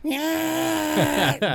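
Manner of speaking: high falsetto rock wail; imitating singer's yell. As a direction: squeal it like a metal singer. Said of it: "that goddamn singer from fucking Raven going".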